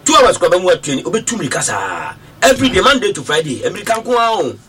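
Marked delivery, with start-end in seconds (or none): none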